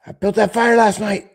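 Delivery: high, loud taunting voice; skit voice. Acted. Skit insult exchange.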